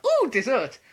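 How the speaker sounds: high, loud excited squeal; shouted out. Retold or imitated. Vlogger reminding his mum of his outburst, loud high squeal. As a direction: shout it like a kid who just saw pudding. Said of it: "when I shouted out".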